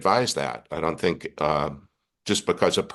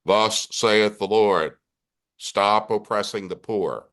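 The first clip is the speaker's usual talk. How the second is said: slow, solemn proclamation, a touch higher; voice of God; 3 semitones higher, slower than usual. Shown as a demonstration. Reader voicing God in an Old Testament reading, slow and solemn. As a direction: intone it like a prophet speaking for God. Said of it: "so it's the voice of God".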